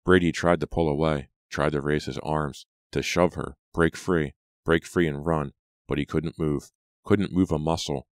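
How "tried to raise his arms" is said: ordinary delivery, nothing marked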